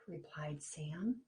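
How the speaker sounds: ordinary delivery, nothing marked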